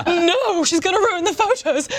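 aunt at the wedding dreading ruined photos; panicked aunt. Retold or imitated. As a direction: voice the aunt's dread about the photos. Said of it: "full of English dread, is like".

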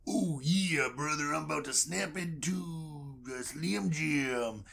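a hint of a gruff wrestler's growl; lightly voiced Macho Man promo. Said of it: "It's Macho Man Randy Savage".

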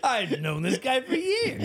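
high-pitched mock bragging; hammed-up old-pal swagger; puffed-up confidence. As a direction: puff your chest out and boast. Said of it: "like, puffing your chest out".

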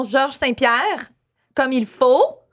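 Georges Saint-Pierre said slowly in Quebec French, ZHORZH san-PYAIR; slow, proper French Georges Saint-Pierre; Quebec French pronunciation. Shown as a demonstration. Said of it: "je veux dire ton nom".

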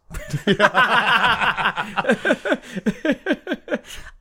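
long, high burst of laughter. Real, not acted. Host cracks up at his own answer, laughing long. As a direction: crack up and let the laugh tail off.

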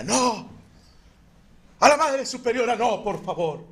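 high, loud pleading cry; frantic pleading. Acted. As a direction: beg them desperately to spare her. Nun begs the intruders. (súplica aguda y desesperada)

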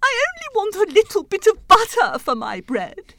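high, loud mock wail; plaintive whimpering. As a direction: wail it like a spoilt, wronged king. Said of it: "he whimpered".

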